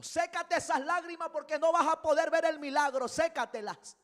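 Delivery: high, very loud preaching shout; shouted command. Acted. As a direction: cry it out urgently as Jesus' order. Preacher shouts Jesus' command to widow. (orden gritada con fervor)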